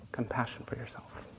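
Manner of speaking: soft unvoiced whisper. Real, not acted. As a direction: breathe the words gently. Soft closing of meditation instruction.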